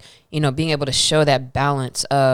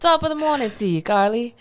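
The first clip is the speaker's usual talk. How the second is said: a clumsy, higher mock Irish accent; bad Irish accent; 7 semitones higher than usual. Cohost teasing Carly with a botched Irish accent. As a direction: fake Irish lilt, hammed up and failing. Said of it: "Let me work on my accents".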